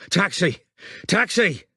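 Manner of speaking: rising repeated hail, high; calling shout for a taxi; bellowed. A narrator's reading. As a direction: bellow for the cab twice. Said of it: "Strike bellowed".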